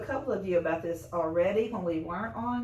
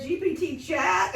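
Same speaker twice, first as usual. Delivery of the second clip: word order mixed up; 6 semitones higher, 3 dB louder, slower than usual. Retold or imitated. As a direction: say "G P T chat" with the words backwards, a touch higher and slower. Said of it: "And then I was saying".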